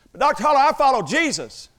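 high, loud imitation of an earnest young student; mock an eager student's protest. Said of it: "one student, he's nineteen years old".

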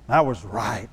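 dropped low and soft, stubborn; low grumble. Retold or imitated. Man insisting to himself he was right, low grumble. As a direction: grumble it under your breath.

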